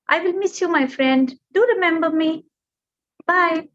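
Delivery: high, bright little bird goodbye; cheerful bird farewell. Acted. Bird saying goodbye to the mountain, high and fond. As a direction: part fondly from a friend.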